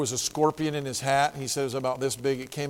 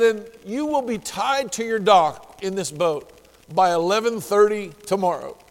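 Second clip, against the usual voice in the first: high, loud booming divine voice; voice of God; 5 semitones higher, 5 dB louder than usual. Retold or imitated. Preacher voicing God's promise to the dying fisherman, booming and high. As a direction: proclaim it like God speaking from above.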